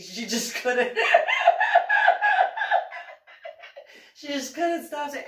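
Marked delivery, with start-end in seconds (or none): 1.0-4.1 high loud laugh; real laughter. Real, not acted. Laughing at the memory.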